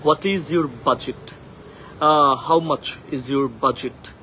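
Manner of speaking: "What is your budget?" slowly, every word clear; slow model sentence. Shown as a demonstration. Teacher drills the phrase slowly. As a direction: spell it out slowly for learners. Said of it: "budget না বলে directly budget".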